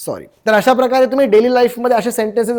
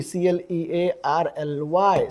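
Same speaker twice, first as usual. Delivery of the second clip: slow letter-by-letter spelling; 5 semitones lower, 7 dB quieter, much slower than usual. Shown as a demonstration. Teacher spelling clearly letter by letter, slowly. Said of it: "त्याला clear म्हणतो".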